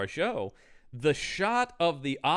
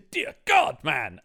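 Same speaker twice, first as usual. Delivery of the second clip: loud outburst; 4 dB louder, slower than usual. Real, not acted. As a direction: burst out in disbelief. Laughing outburst at the scene.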